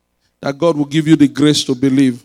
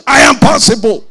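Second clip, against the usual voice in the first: loud, raised, confident declaration; with confidence; 8 semitones higher, 9 dB louder than usual. Shown as a demonstration. Preacher showing the congregation how to declare it, with confidence. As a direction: say it like you mean it. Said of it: "Say it with confidence".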